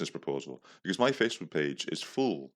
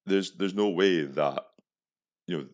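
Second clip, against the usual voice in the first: ordinary delivery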